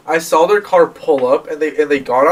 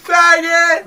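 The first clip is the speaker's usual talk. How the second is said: high shouted slur from a passing car; mock drive-by yell; imitated yell; 11 semitones higher than usual. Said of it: "this guy from our high school went".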